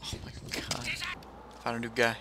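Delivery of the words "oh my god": whispering. Real, not acted. Awed under his breath.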